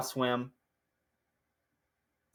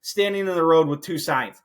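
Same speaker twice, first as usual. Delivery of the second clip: exasperated yell; 7 semitones higher, 7 dB louder than usual. Retold or imitated. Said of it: "I'm like".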